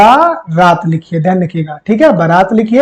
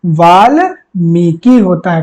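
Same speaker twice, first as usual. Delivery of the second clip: Valmiki said slowly and lower; slow down to model Valmiki; teacher modelling Valmiki slowly; 4 semitones lower, much slower than usual. Shown as a demonstration. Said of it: "क्या होता है आपका?".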